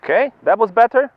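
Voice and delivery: bright calls up the slope; cheery ski instructor shouting. Real, not acted. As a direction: call out praise to a skier below. Instructor calling to skier on slope.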